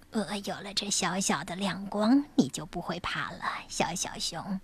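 low, gentle bedtime whisper; soft reassuring whisper. Acted. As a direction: soothe a scared cub quietly. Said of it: "说". (温柔的安慰低语)

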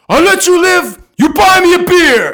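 high, loud ultimatum; imitating a bellowed order; mock commanding shout. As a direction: holler terms at a pinned man. Don Vito dictating terms.

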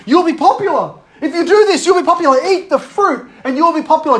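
high, loud, excited promise; insistent, hyped-up temptation; acted tempter. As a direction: dangle it like a prize.

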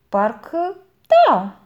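slow, high, half-hearted yes; boy hedges under his aunt's warning; reluctant boy. A narrator's reading. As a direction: drag out a grudging agreement. Boy answers aunt.

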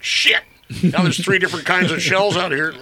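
flustered townsman griping; flustered exclaim. Retold or imitated. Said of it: "and they're like".

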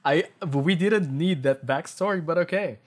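loud, high amused protest; laughing. Real, not acted. Reader reacting to the needless backstory, laughing. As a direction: comment through real laughter.

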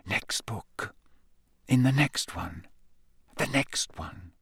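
tired breathy whisper; whispered mutter. A narrator's reading. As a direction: push on half asleep. Tired self-urging.

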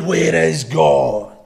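booming low, very slow bellow; big man's booming demand; bellowing preacher. Acted. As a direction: bellow it deep and drawn out. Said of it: "and he bellowed".

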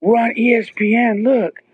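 very high, thrilled kid imitation; excited child. Son shows dad they made TV, high excited mimicry. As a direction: mimic a son bursting with news.

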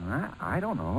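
hushed, unsure half-whisper. Acted. Pilot waiting nervously for the banker, hushed and unsure. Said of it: "say, why are we whispering?".

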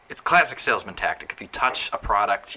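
normal talking voice

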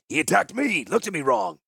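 high-pitched, insolent protest; defiant excuse. A narrator's reading. Crossbow master interrupting his angry commander, high defiant protest. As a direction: cut in and justify yourself, pitched high. Subordinate defends himself to commander.

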